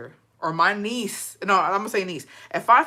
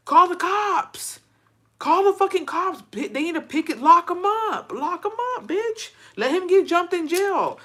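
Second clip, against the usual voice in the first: outraged yelling; 9 semitones higher than usual. Real, not acted. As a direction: shout orders at useless bystanders. Yelling at the onlookers to act.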